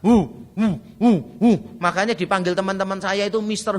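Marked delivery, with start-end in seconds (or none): 0.0-1.5 imitating a toddler's only word; short hooting syllables, toddler-like; menirukan anak kecil. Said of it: "anak saya cuma bisa satu kata". (menirukan celoteh anak kecil)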